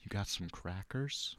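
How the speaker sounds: slow, hushed, low impression; George Washington Carver. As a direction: draw it out softly and deeply. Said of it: "Give me your best George Washington Carver".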